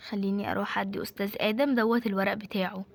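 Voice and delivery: ordinary delivery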